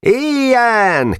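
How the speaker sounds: high, loud cheer; shouting together. Acted. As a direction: cry it out all at once. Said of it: "kiáltotta egyszerre mind".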